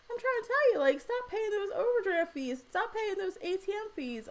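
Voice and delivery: high-pitched, insistent plea; emphatic urging. Real, not acted. Host warning viewers off bank fees, emphatic urging. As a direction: plead your case with pitch held high.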